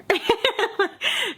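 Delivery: high-pitched burst of laughter; high, spontaneous giggle; real laugh. Real, not acted. Laughs at her own retort.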